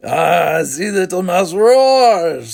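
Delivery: mock his younger brother; bitter. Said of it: "said Robert bitterly".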